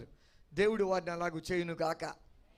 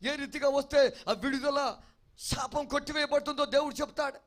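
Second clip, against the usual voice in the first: louder, higher fervent pulpit preaching; preaching at full force; 4 semitones higher, 3 dB louder than usual. Acted. Preacher urging the congregation to obey God, fervent full-force preaching. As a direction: drive each promise home like a revival preacher. Preaches loudly about God's guidance.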